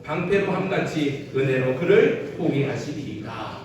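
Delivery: measured, solemn reading of a psalm; scripture reading. Acted. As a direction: read the verses aloud like worship. Congregation reads Psalm aloud. (성경 봉독하듯 엄숙하게)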